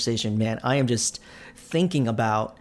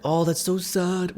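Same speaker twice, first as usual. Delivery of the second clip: mock sad; 6 semitones higher, slower than usual. Real, not acted. Reacting to sad game moment.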